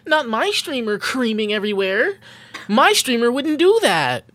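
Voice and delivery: acted-out indignant commenter; indignant chat viewer. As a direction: defend your streamer like a scandalized fan. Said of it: "YouTube chat would be like".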